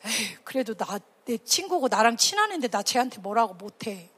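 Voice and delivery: low, soft reluctant mumble; quietly making excuses. Acted. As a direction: back away from confronting a friend. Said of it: "여러분이 어떤 마음이냐면".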